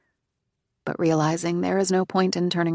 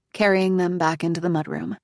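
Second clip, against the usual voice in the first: plain reading voice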